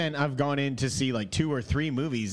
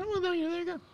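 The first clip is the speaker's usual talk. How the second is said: high squeaky cartoon mouse voice; piercing squeak like a cartoon mouse; Mickey Mouse voice; 3 dB quieter, faster than usual. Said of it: "like a bad Mickey Mouse impression".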